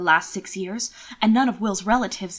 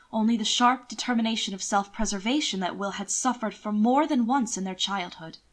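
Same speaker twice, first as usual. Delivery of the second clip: ordinary talk, nothing marked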